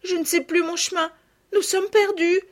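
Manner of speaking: high acted child's wail; tearful despair. A narrator's reading. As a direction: cry out, distraught and high. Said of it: "en éclatant en sanglots". (fillette en pleurs, désespérée)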